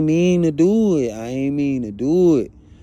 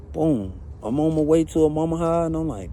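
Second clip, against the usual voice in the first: ordinary delivery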